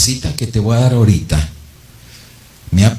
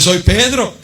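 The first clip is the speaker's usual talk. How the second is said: Peter at the door; 9 semitones higher, slower than usual. Acted. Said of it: "Y dice".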